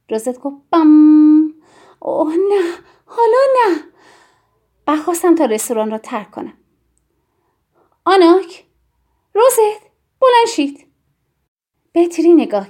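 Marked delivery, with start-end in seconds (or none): 0.7-1.5 loud, higher-pitched drawn-out boom; booming "bam". A narrator's reading. Little Rosette answers with a sound, booming "bam". 2.0-3.8 high, rising cry of dread; alarmed plea. A narrator's reading. Yann dreads a scene in the restaurant, alarmed plea. Narrator dreads what child starts. 8.0-10.9 high, loud call to get up; urgent command. A narrator's reading. Yann orders the children out of the restaurant, urgent command.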